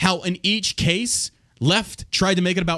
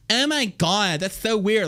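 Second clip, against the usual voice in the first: higher-pitched mock camp voice; 6 semitones higher, slower than usual.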